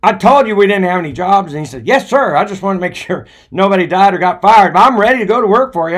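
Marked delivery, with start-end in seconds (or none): 0.0-1.5 irritated boss. Retold or imitated. Manager voiced, irritated. 1.8-6.0 high, loud, tumbling out fast; eager young Les. Retold or imitated. Les insisting to the manager he is ready, eager.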